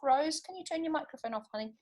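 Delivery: ordinary delivery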